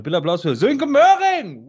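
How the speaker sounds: building to a loud, high name call; grand entrance announcement. Acted. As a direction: announce him like a stadium MC. Introducing guest to applause.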